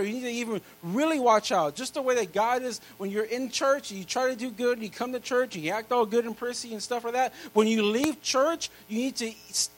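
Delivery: loud, high preaching build; full-force preaching. Acted. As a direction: drive the point home to the congregation.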